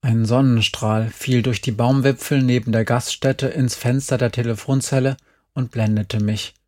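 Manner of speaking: plain reading voice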